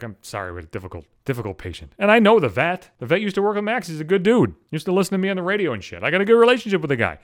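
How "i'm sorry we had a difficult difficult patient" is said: much softer, lower and quick, a meek polite apology. Retold or imitated. Vet staff apologizing for the delay, soft polite voice. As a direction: imitate the staff apologizing gently. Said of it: "the vet people were very polite".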